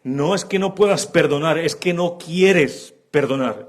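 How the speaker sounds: quieres slowed and stressed against puedas. Acted. Preacher correcting the congregation, stress on quieres. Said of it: "Es diferente".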